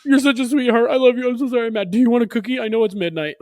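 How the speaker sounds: squeaky high mock-parent voice caving in; mock doting parent. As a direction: gush over the kid like a pushover parent. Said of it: "You're like".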